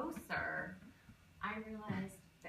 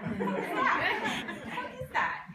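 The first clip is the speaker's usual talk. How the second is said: high, loud incredulous squeal; mock shocked; 13 semitones higher, 9 dB louder, slower than usual. Comic quoting her past self, shocked squeal. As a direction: squeal it in disbelief. Repeated exclaim, mock disgust.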